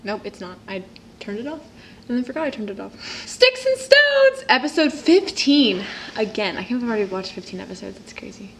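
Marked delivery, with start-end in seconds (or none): 3.3-5.8 high, drawn-out show-title call; episode title announcement. Pretend. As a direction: stretch the title out high and loud.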